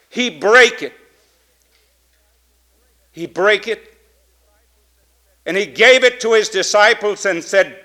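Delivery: loud, urgent reading of Paul's words; fervent scripture read. Acted. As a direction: proclaim the text to the church. Reads scripture with force.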